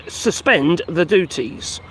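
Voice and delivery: ordinary talk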